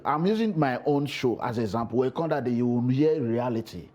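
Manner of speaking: usual speaking voice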